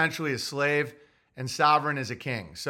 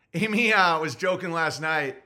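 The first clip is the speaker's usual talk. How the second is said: ordinary delivery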